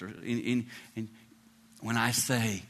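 ordinary talk, nothing marked

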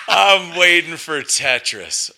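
slow, high Australian drawl; Aussie accent. Retold or imitated. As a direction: repeat it like the Aussie woman. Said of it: "with the accent and the whole thing".

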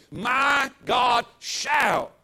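slow, loud, stretched-out proclamation; leading the church in a loud, drawn-out declaration; declared loudly. Shown as a demonstration. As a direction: stretch each word and shout it out. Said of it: "At home, say it with me".

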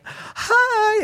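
a sugary high hi to an angry driver; fake-friendly singsong hello; overly cheery hi. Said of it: "waved with a huge smile".

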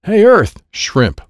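high, loud alien heckle; taunting yell. A narrator's reading. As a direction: yell it at your rival. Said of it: "Gloopy will yell at me".

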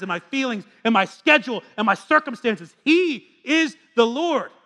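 fervent preacher climax; preaching. Acted. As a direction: build the list up to a rousing peak. Building sermon climax.

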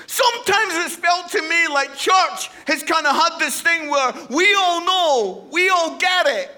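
loud, high, rising preacher's cry; full-force preaching shout. Real, not acted. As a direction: raise it to the back row.

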